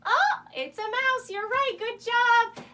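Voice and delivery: high, loud cheer for little guessers; excited praise. Pretend. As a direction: praise them with delight. Praises children's correct guess.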